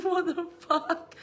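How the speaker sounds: high, slow laughed-out protest; laughing disbelief. Retold or imitated. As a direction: draw it out through laughter. Mock outrage at hubby's rule, laughing.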